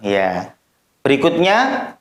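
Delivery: usual speaking voice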